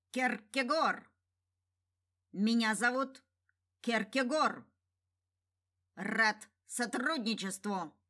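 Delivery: sharp clicking birdlike voice; clipped, clicking bird-man; clicking alien. Acted. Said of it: "произнёс резким, щёлкающим голосом".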